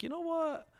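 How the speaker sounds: jumps way up on what; sudden exasperated you know what; high exclamation. Real, not acted.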